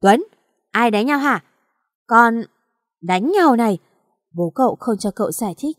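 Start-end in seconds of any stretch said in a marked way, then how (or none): 0.0-3.8 angry father yelling. Acted. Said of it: "quát lớn".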